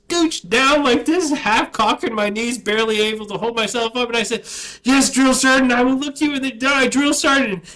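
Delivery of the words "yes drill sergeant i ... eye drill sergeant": loud, high barked answer to the sergeant; shouted recruit reply. Retold or imitated. Recruit shouting back at his drill sergeant. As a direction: yell it out at attention.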